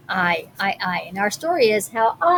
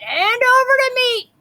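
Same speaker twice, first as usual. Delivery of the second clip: higher, louder crew yell; yelling for meat; 12 semitones higher, 5 dB louder than usual. A narrator's reading. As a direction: holler for the meat. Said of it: "we all yelled".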